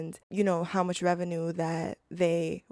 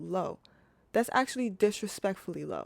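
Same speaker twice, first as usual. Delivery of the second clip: ordinary delivery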